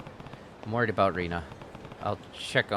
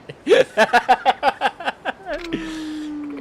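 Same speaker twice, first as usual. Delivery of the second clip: loud high giggling; high laugh; 6 dB louder than usual. Real, not acted. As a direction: keep cracking up. Laughs again.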